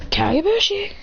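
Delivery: unsure little whisper. Acted. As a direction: breathe the word like a secret idea. Character whispers an idea.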